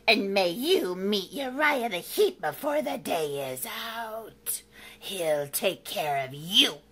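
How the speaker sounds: witch's curse, slowing at the end; menacing witch. Acted. Witch cursing the monster, menacing. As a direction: draw out the threat like a witch. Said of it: "cried the witch".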